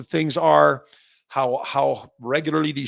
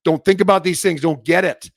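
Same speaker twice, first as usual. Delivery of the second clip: fired up; 3 semitones higher, 8 dB louder than usual. Real, not acted. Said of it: "I do get upset".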